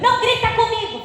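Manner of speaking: shouted high and loud, imitating an angry parent; a parent shouting at a child who yelled first, imitating the shout; mock parent yell. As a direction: yell it back at the kid the way a hypocritical parent would. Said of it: "você responde gritando".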